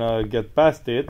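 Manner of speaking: ordinary talk, nothing marked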